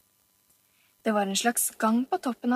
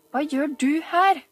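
higher, louder, pressing repeat; harder repeat; 6 semitones higher, 4 dB louder than usual. Acted. Oliver repeating to Camilla, pressing harder. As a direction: squeeze the answer out of her. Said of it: "gjentok han og klemte hardere".

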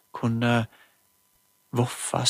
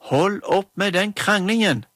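a loud, higher call to stop; shouted order; 6 semitones higher, 5 dB louder than usual. A narrator's reading. Mamma in the doorway shouts at the quarrelling girls, loud. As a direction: shout across the room to end it. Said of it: "ropte mamma".

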